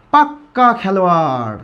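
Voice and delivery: slow "পাক্কা" with a held double k; emphatic doubled consonant. Shown as a demonstration. Teacher gives the emphatic example of a player, "পাক্কা" with doubled k. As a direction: lean on the kk of "পাক্কা", drawing it out. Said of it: "জোর দিতে গেলে কী বলি".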